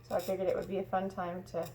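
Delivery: ordinary delivery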